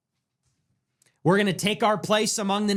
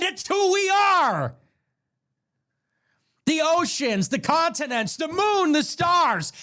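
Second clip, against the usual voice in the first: rousing rally cry; 8 semitones higher than usual. Real, not acted. Preaching expansion to the chat, building to a shout. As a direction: whip the crowd up about who we are.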